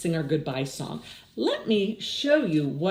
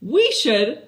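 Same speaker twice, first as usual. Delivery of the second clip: eager suggestion; 6 semitones higher, 6 dB louder than usual. Acted. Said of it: "said Clayton".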